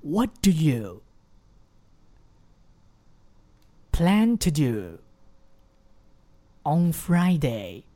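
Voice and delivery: slow, in three separate chunks; slow chunked model. Shown as a demonstration. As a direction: read it slowly in pieces. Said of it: "再来一次". (分段慢读)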